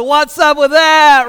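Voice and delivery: loud, slow, high taunting brag; mock gloating. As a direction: strut and crow over the little kid. Gloating over beating daughter.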